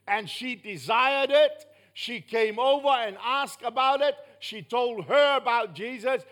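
preacher building with fervor; full-force preaching. Real, not acted. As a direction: drive the testimony home loudly.

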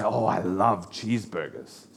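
low, soft mock gushing; retold food lover. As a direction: quote a cheeseburger fan in an understated, low murmur. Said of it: "someone will say".

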